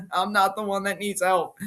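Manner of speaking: imitated chuckling through the words; mock laughing defiance. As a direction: laugh it off as the unfazed wrestler. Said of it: "again, he's laughing".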